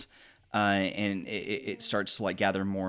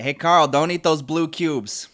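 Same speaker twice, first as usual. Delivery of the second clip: calling out; 5 semitones higher, 7 dB louder than usual. Acted. Calling out to the rat king.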